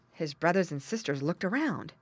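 plain reading voice

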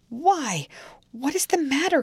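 high, urgent cry of alarm. A narrator's reading. Servant crying out to frightened mistress, alarmed. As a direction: call out to her in sudden alarm. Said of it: "cried I".

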